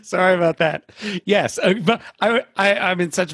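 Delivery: laughing sheepishly at own blunder. Real, not acted. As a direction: laugh it off, embarrassed. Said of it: "I'm slapping my microphone around".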